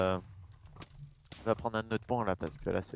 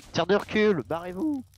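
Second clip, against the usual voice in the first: higher, louder shouted callout; urgent callout; 7 semitones higher, 5 dB louder than usual. Real, not acted. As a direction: yell the ability name mid-fight.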